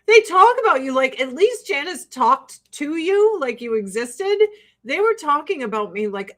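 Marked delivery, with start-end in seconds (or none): 0.1-0.9 laughing. Real, not acted. Laugh-crying about catty judges.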